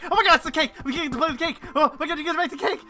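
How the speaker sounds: very high, loud, fast gushing; high squealing glee, laughing; excited friend. Retold or imitated. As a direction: imitate a friend freaking out with joy. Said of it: "she lost her mind".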